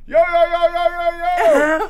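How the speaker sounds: high yelled yo yo; calling out loud. Retold or imitated. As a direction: shout it from far off, high. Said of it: "I hear this voice in the background coming this way going".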